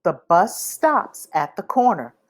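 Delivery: plain reading voice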